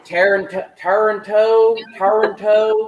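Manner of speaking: demonstrated Texas accent with enunciated vowels. Shown as a demonstration. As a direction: say it like a Texan, leaning on the vowels. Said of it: "Texas voice".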